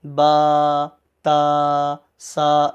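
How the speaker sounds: "با، تا، سا" sustained on a level note; held, monotone "با، تا، سا"; long drawn-out vowels. Shown as a demonstration. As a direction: stretch each syllable evenly. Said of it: "کھینچ کر پڑھتے ہیں".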